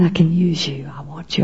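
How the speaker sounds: soft unvoiced whisper. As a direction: breathe it like a gentle inner call. God's voice in head.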